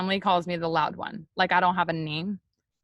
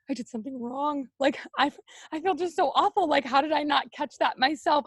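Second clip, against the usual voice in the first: retold tearful dismay; 6 semitones higher than usual. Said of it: "be in tears".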